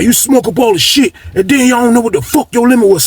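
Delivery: high-pitched mock argument; imitated indignation. Retold quarrel over the limit.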